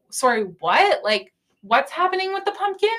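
baffled question climbing high at the end; mock-confused, incredulous high-pitched query; retold confusion. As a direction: act out total bewilderment, pitch rising. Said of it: "like I'm so confused".

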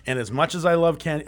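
normal talking voice

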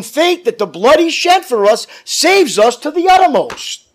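rising, loud revival preaching, slowing on key words; preaching at full force. Acted. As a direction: build the congregation up with fire. Preacher building to climax.

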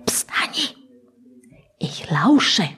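breath-only shushing whisper. Acted. Listening for knocking in the dark, shushing whisper. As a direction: hush the child in a whisper.

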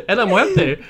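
Spanish words broken up by laughter; a line of Spanish lost in laughter; laughing mock Spanish. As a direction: try an accent and crack up. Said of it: "some elderly woman's like".

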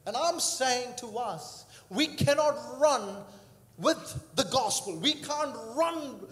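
rally the congregation to run with the fire; fervent preaching. Acted. Preacher builds to a climax.

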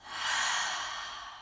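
long audible breath out; deep relaxing sigh. Shown as a demonstration. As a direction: sigh out slowly and deeply. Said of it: "just take a big breath".

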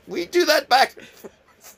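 high, slow, laughing through the words. Retold or imitated. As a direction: giggle out the excuse. Laughing while quoting his excuse.

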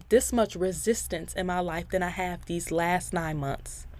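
ordinary talk, nothing marked

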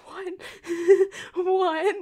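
high, startled double take; shocked disbelief. Retold or imitated. Told right before going on, startled disbelief. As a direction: react as if the news just hit. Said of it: "and I'm like".

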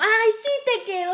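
a high, gushing mock of an aunt; mock surprised relative. Mocking relatives who celebrate that clothes fit, high gushing.

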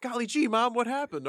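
put-on high, eager golly-gee kid voice; wholesome 1950s kid. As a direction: play a naive fifties sitcom boy.

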